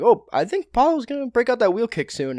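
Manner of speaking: a quick casual take on the commentator calling the next move; Joe Rogan impression. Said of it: "Joe Rogan's like".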